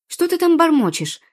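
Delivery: high, loud, cross question; angry demand. A narrator's reading. Poma cross at the muttering boy, angry demand. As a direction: snap it out loudly, fed up. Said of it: "рассердилась Пома".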